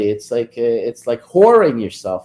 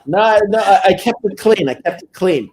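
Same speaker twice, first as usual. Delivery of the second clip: excited, laughing protest; 8 semitones higher, 6 dB louder than usual. Real, not acted. Laughing defence of clean sign-off.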